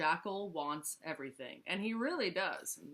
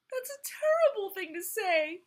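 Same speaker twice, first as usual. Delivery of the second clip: sobbing; 14 semitones higher, 7 dB louder than usual. Acted. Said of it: "he sobbed".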